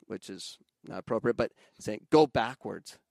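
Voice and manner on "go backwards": loud mock sideline yell; retold scream, moderately raised; imitated parent yell. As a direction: call it out loud like a parent on the touchline. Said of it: "a parent screaming at their daughter".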